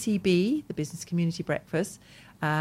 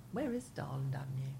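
whisper; 3 semitones higher, 12 dB quieter than usual. Real, not acted. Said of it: "whispered aside".

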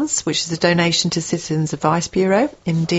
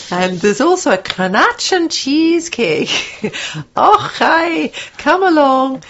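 high, hammed-up Scottish voice; bad Scottish accent; 7 semitones higher, slower than usual. Shown as a demonstration. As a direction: coax listeners in a comic fake Scottish accent. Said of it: "That is my Scottish accent".

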